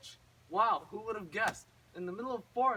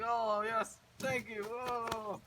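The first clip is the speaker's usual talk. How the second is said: higher, drawn-out gushing thanks; mock relieved gratitude; 4 semitones higher, much slower than usual. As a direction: gush with hammed-up relief. Acted rescued brother thanking Gretel.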